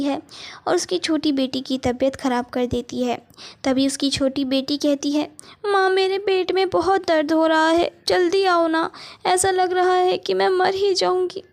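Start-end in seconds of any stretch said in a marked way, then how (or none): 5.7-11.4 put-on higher, whiny little girl in pain; a little girl with a stomach ache crying out for her mother; sick child. Sick younger daughter calls mother. (पेट दर्द से कराहती छोटी बच्ची की ऊँची आवाज़)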